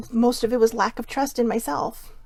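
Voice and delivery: ordinary talk, nothing marked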